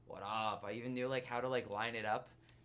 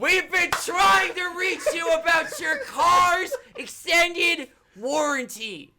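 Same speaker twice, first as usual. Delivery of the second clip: slow, very high, very loud spam-call bellow; hammed-up extended warranty yell; mock robocall shout; 20 dB louder, slower than usual. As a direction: yell it like a mocking scam call. Mock robocall after bad joke.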